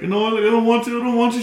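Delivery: put-on high-pitched childlike protest; mock whining kid. Kid refusing his mom's onion trick, mock whiny pleading. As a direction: imitate a kid pleading not to.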